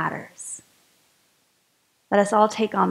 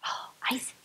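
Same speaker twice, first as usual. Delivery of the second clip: whisper; 13 dB quieter, faster than usual. Real, not acted. Gasped whisper about ISIS.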